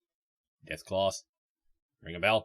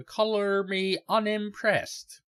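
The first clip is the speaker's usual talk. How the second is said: high, slow mock-unimpressed line; 11 semitones higher, slower than usual. Player answering Harold's joke, high and slow mock unimpressed. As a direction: draw it out high, playing unimpressed. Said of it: "my look would say".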